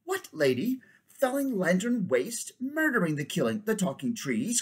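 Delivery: high, outraged disbelief; cried. Acted. King crying out at the dryad's news. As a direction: cry out in shock. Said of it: "cried the king".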